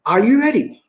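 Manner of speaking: usual speaking voice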